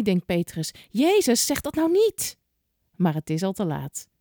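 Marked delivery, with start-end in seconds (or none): 0.9-2.4 anxious plea. A narrator's reading. Petrus pleads silently with Jezus.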